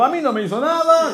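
squeaky defensive fan; high mock fan voice; heckler. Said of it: "hay uno ahí tipo".

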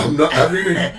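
mock indignant refusal with a laugh; hammed-up catchphrase, laughing. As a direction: play up the catchphrase, half laughing. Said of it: "a phrase that you use".

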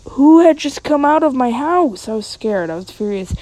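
plain reading voice

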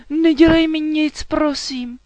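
high, loud plea for mercy; frightened pleading. A narrator's reading. Frightened knight begging the dragon not to hurt him, panicked. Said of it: "koktal rytíř".

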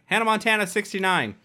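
high, loud blurted-out announcement; joking shout. Acted. Shouting a joke Wi-Fi password to the room, high and loud. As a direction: shout it out proudly for laughs. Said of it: "I always shout out an embarrassing one".

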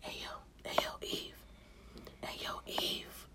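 hissy whisper, slow at the end; whispered temptation from the snake. Acted. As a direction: lure her in a whisper.

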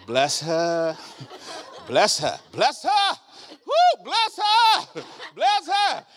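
high, loud, building cry of bless her; shouted blessing. Acted. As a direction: bless her at full force.

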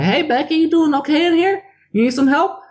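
high, sweet mock customer-service voice; piping up in a sugary put-on voice; mimicking the lady. S1 mimics the lady checking on Becky, high and sweet. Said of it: "She's like".

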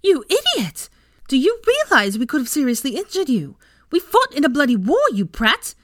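high, loud, furious scolding; angry screaming. A narrator's reading. Ginny berates the boy who hexed her friend, screaming in anger. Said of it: "Ginny was screaming in anger".

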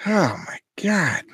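slow, high, exasperated sigh of disbelief; real exasperation. Real, not acted. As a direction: drag out the disbelief. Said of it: "exasperated at stunned parents".

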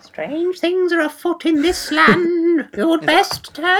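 high, drawn-out spooky wail; hammed-up ghostly singsong, stretched out; mock spooky warning. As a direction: echo the warning in a very slow, eerie high voice. Mocking echo of the lady's warning.

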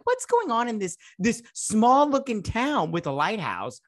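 high disbelieving question; high baffled rant. Retold or imitated. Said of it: "I'm all like".

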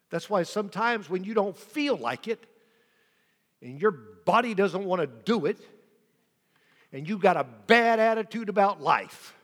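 fervent sermon build; rising preaching build. Real, not acted. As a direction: lean into the congregation.

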